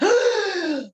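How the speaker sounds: a high, sharp gasp of mock horror; hammed-up parent's gasp of shock.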